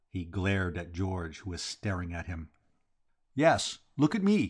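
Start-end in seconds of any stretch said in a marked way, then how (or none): 3.3-4.5 raised, high-pitched yell; angry yell. A narrator's reading. Vowles confronting the staring George, yelling. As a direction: yell it as a challenge. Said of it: "he yelled".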